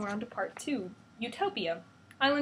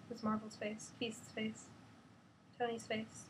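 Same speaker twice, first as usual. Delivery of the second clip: ordinary delivery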